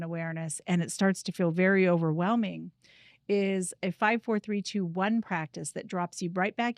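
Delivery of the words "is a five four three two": normal talking voice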